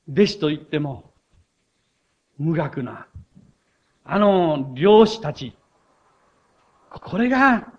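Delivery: ordinary delivery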